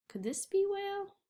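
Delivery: high, puzzled could this be whale; mock wondering. Teacher pretending to puzzle over a word. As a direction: ask with playful doubt.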